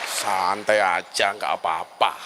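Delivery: very low, slow, drawn-out calm; relaxed and low, no rush at all; laid-back reassurance. Real, not acted. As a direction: soothe them in a deep, unhurried voice. Telling listeners to stay relaxed.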